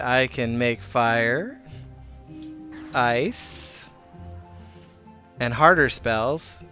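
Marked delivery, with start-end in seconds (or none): none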